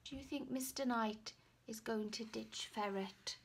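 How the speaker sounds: ordinary delivery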